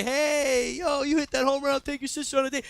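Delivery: high-pitched heckle from the stands; fun smack talk. Retold or imitated. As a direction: call out teasingly to the player. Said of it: "talking fun smack at a baseball game".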